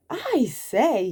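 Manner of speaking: a burst of delight; happy surprise at the window; excited exclaim. Acted. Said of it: "he said".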